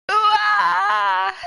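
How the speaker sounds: loud high goofy whoop; silly ooh-ah cry. Acted. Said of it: "his little ooh-ah was so silly".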